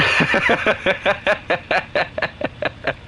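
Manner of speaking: long run of giggles, dying away. Real, not acted. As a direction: crack up at your own question. Laughs at own question.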